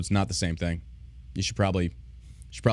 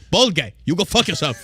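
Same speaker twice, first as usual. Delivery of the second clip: higher, louder put-on telling-off; China telling him off; 6 semitones higher, 6 dB louder than usual. China yelling at bald Prince William, high and loud. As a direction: shove the insult right back at him. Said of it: "'Cause China be like".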